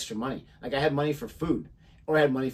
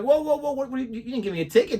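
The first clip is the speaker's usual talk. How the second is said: high, stammering mock alarm; retold panicky objection, tumbling out fast; alarmed protest; 8 semitones higher than usual. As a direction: reenact rushing to stop a cop. Retells protesting to the cop.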